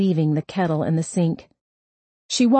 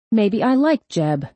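higher, drawn-out petulant retort; 4 semitones higher than usual. A narrator's reading. Sarah countering her mother about liking Jeb, petulant. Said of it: "aware there was a petulant tone to her voice".

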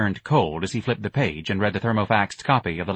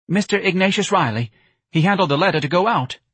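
nervous office manager; 9 semitones higher, 5 dB louder than usual. A narrator's reading. Nervous office manager answers boss.

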